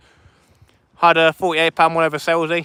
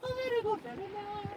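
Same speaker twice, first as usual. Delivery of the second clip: very high squeaky praise voice; falsetto very good; 19 dB quieter than usual. Pretend. Fisherman praising the fish in a silly high voice. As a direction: squeak it out like a cartoon.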